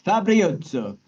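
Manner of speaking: Fabrioso said high with rolled Italian flair; exaggerated Italian accent on Fabrioso. Shown as a demonstration. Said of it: "do my Italian accent".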